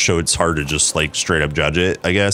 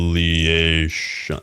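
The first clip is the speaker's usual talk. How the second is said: drawn-out game announcer call; Quake announcer; slower than usual. Retold or imitated. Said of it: "I had to sound it out in my head".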